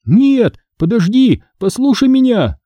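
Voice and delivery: high, desperate begging; pleading. Acted. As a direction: beg the ghost for your life. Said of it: "молил я". (мольба в отчаянии)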